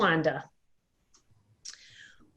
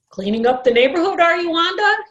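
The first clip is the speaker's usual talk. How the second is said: fast, louder, higher friendly call; hearty neighbor; 10 semitones higher, 6 dB louder, faster than usual. Acted. Mister Claudel calling to Wanda after work: fast, loud, hearty. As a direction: boom out a greeting on the way home.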